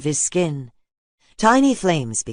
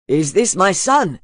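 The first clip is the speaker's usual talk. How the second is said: a father calling out, higher and louder, hopeful; eager father's call; 5 semitones higher, 6 dB louder than usual. A narrator's reading. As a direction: shout across the field, hoping it's true. Father runs up to his son.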